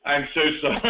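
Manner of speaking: usual speaking voice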